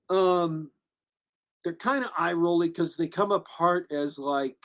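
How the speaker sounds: ordinary talk, nothing marked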